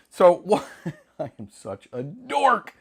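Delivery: usual speaking voice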